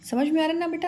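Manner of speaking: ordinary delivery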